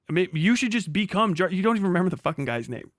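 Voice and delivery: ordinary talk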